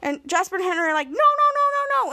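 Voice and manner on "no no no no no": high-pitched string of mock panic; head off the spoiler in alarm; imitated high, urgent protest; panicked refusal.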